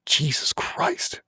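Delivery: slow, voiceless exclamation under the breath; hushed, drawn-out disbelief; stunned whisper. Retold or imitated. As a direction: whisper it slowly in shock. Stunned on seeing smoking hands.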